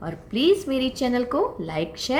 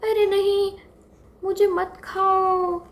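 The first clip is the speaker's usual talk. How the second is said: high-pitched frightened pleading; a small child begging not to be eaten; scared little deer; 9 semitones higher than usual. Acted. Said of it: "छोटा सा हिरन तो डर गया". (डरे हुए बच्चे की ऊँची आवाज़)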